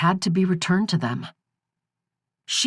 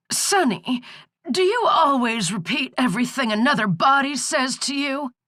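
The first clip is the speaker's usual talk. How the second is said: put-on croaking old crone; 9 semitones higher than usual. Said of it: "the croaking was starting to hurt her throat".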